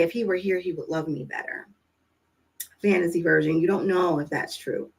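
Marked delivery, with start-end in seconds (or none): 0.0-1.7 low, soft, wistful aside; imagined child's longing. Acted. Child imagines an absent father's love, soft and wistful. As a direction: lightly voice a wistful child, trailing softer. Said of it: "where they might say".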